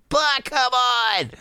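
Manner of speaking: put-on high, drawn-out kid whine; pleading child. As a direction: stretch out a high childish plea.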